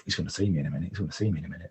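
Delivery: low, hushed, frightened murmur to himself; panicked inner thought. Retold or imitated. Hiding under a tarpaulin, praying the driver won't spot him, hushed and scared. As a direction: keep it down so nobody hears you.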